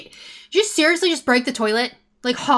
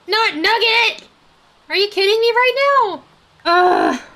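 high, loud wail of disbelief; upset outcry; 8 semitones higher, 7 dB louder than usual. Real, not acted. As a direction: cry out in exasperated dismay. Favourite chicken lost.

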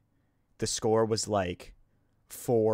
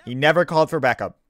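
NEVER hit hard and loud, voice raised; loud rebuttal; 4 semitones higher, 9 dB louder than usual. Real, not acted. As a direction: cut in firmly, punching never.

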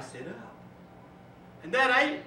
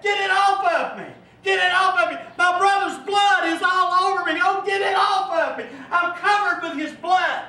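high, loud anguished shouting; Cain screaming; 5 semitones higher, 5 dB louder than usual. Acted. Preacher voicing Cain in hell, anguished shouting. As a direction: scream it as a tormented man begging God. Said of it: "we hear screams coming inside".

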